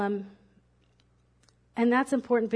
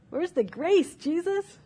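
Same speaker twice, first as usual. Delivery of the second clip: pleading question; 6 semitones higher, slower than usual. Real, not acted.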